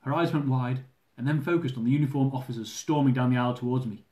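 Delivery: ordinary talk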